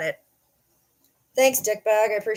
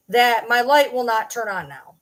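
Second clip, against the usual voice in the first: usual speaking voice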